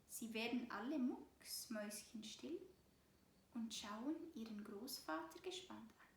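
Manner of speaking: ordinary delivery, nothing marked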